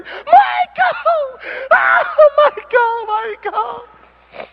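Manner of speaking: high, crying fan screams; mock screaming fans. Preacher imitates a crowd of fans screaming for Michael.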